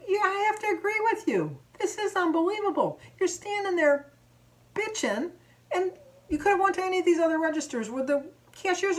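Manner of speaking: high-pitched sarcastic agreement turning to scolding; indignant retort. Retold or imitated. Shopper scolds customer about other open registers, indignant. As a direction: act out arguing back, sustained high pitch. Acted quarrel, arguing back.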